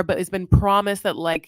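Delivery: ordinary talk, nothing marked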